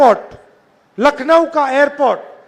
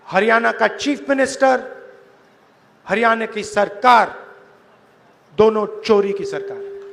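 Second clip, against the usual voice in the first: ordinary delivery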